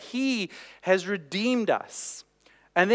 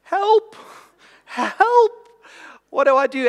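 high, loud mock panic, pleading; panicked cry for help; 7 semitones higher, 5 dB louder, slower than usual. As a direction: squeak it out like a frightened inner voice. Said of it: "a little voice inside me that was just crying".

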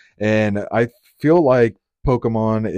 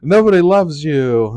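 raised, slow and loud, sneering "get outta here"; mean shoo-away voice; 6 semitones higher, 7 dB louder, slower than usual. Retold or imitated. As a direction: shoo it off like a bully, slowly. Mocking someone shooing a creature.

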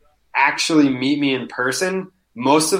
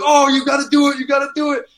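egging on; 12 semitones higher, 3 dB louder, faster than usual. Retold or imitated. Friends egging him on.

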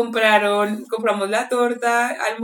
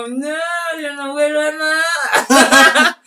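loud, drawn-out protest, half laughing; mock drunken denial; 5 semitones higher, 6 dB louder, much slower than usual. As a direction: play a drunk insisting, stretching the words. Acts out her drunken denial.

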